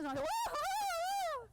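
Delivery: high, slow squealing excitement; shrieking jackpot winner. Retold or imitated. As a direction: squeal it out like an overexcited pup. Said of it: "You see how he says".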